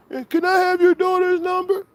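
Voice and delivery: high mocking caller voice. Streamer retelling the troll's call, mocking voice. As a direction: pitch up to mimic the prank caller.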